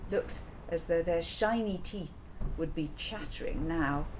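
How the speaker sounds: ordinary delivery, nothing marked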